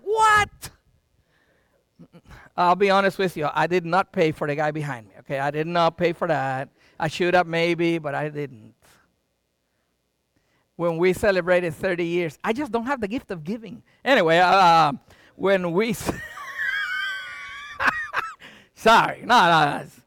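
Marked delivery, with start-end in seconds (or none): 0.0-0.7 very high mock-shocked exclamation; astonished. Retold shock at Starbucks. 16.2-18.3 high-pitched wheezing laugh. Real, not acted. Laughs at own confession.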